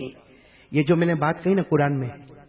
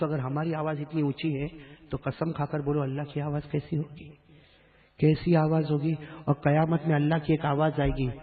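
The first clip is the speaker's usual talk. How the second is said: ordinary talk, nothing marked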